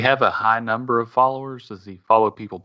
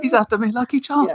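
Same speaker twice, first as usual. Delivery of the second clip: high hammed-up leprechaun voice; cheesy Irish brogue like the cereal ad; mock Irish accent; 13 semitones higher than usual. Host keeps going with the silly Irish accent. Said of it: "when I want to do Irish".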